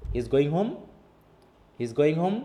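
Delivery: HOME rising sharply, as a question; rising question tune. Shown as a demonstration. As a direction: ask it only through a lift on "home". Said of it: "একটু forcefully বলে". ("home"-এ সুর তুলে প্রশ্ন)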